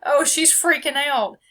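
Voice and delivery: narrating her own meltdown in a mock fret; hammed-up alarmed observer. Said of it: "I can see myself in two forms go".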